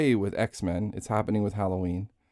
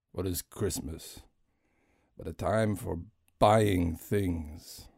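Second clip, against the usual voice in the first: Scrooge. Acted. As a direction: sneer at Christmas like a bitter old miser. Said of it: "one-man Christmas Carol show".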